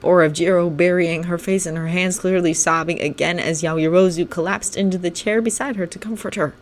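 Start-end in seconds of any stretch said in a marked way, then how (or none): none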